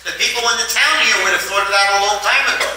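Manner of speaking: high, alarmed protest; alarmed friends. Acted. Friends aghast at the plan.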